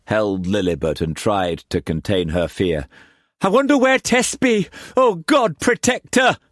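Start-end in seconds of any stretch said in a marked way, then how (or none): 3.4-6.4 high, anxious mother; fearful prayer. A narrator's reading. Liza fearing for Tess in the storm, anxious. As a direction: pray through fear.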